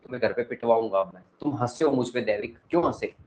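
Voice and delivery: ordinary talk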